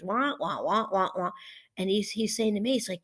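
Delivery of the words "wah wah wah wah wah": mimicked wah-wah grown-up babble; mock whiners as wah-wah noise; Snoopy cartoon adult noise. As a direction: drone wordlessly like a cartoon adult. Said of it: "like the Snoopy cartoon with the adult noise".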